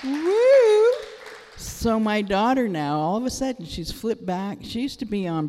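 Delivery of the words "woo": very high, loud whoop of delight; joyful whoop. Retold or imitated. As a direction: shout for joy. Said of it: "I'm like".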